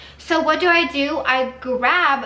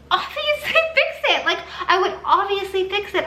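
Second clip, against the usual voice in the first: much higher-pitched, incredulous emphasis; exasperated insistence; 6 semitones higher than usual. Real, not acted. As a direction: insist in a high voice, half laughing at how obvious it is. Exasperated rant about fixing complaints.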